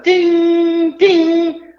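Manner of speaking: high sustained ding ding like a car chime; mimicking the car's seatbelt alarm, a high steady ding; imitated seatbelt chime. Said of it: "I hear all of a sudden".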